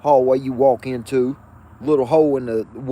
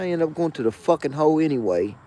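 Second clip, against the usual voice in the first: ordinary talk, nothing marked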